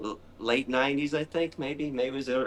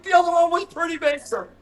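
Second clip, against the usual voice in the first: hammed-up spider fear; panicked retort; faster than usual. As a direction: squeal it back. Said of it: "I'm like".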